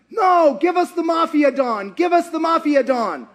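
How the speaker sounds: loud, high shouting crowd; mob demand. Retold or imitated. Crowd shouting for the mafia boss. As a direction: demand the gangster loudly.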